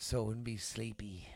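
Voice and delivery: quick whispered aside. Real, not acted. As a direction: drop to a breathy whisper. Aside while telling story.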